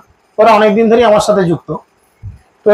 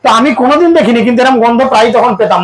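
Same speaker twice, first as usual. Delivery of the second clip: ordinary talk, nothing marked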